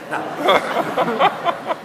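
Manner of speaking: usual speaking voice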